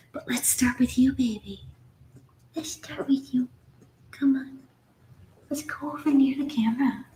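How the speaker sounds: whispered coaxing to a newborn pup; soft whisper to puppy. Real, not acted. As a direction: coax the puppy softly.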